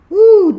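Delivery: a loud high laughing whoop. Real, not acted. Reacting to an old film scene with a whoop. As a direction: let out a big disbelieving whoop.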